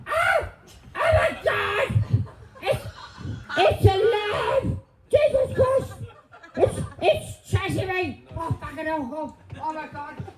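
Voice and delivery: high panicked shrieks and shouts; scared horror game streamer. Acted. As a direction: freak out at something chasing you. Mock panic at imaginary creature.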